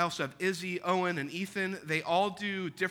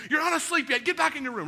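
put-on raised, higher voice telling kids back to bed; scolding kids; 9 semitones higher, 4 dB louder, faster than usual. Parent ordering the kids back to their rooms, raised scolding.

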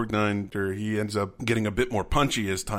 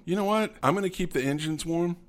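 higher-pitched, put-on offer; mock casual volunteering; 8 semitones higher than usual. Crewman excusing himself from the rescue, high mock-casual voice.

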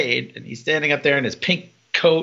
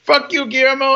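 high-pitched crowing jeer; gleeful hammed-up insult; triumphant mock taunt; 9 semitones higher, 4 dB louder than usual. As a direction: call it down from the sky, imitating him. Triumphant character taunts from the sky.

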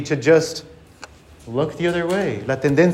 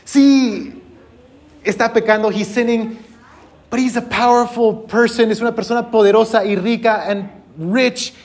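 higher mock excuse-making voice; dismissive churchgoer shrugging it off; higher voice, mock defensive excuse; imitated church members; 4 semitones higher than usual. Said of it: "Or maybe you could hear them saying".

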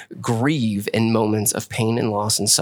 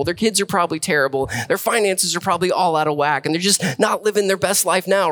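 ordinary delivery

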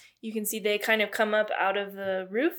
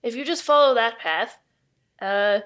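put-on louder, deliberate invented accent; Caroline's put-on accent; 3 semitones higher, 5 dB louder than usual. Astronomer showing visitors out, in an emphatic put-on accent. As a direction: spell out the directions in a put-on accent. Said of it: "what this accent is I've decided on for Caroline".

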